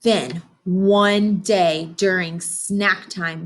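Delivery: put-on gentle storybook narration for kids; picture book reading. A narrator's reading. Teacher reading to her class, storybook voice. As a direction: read it like story time on the rug.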